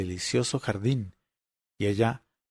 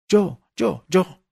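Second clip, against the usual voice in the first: high, loud, repeated cry; excited shout; 8 semitones higher, 5 dB louder, much slower than usual. A narrator's reading. The children wake grandma, excited repeated shout. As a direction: clamour for your turn with rising excitement. Narrator clamoring to claim turn.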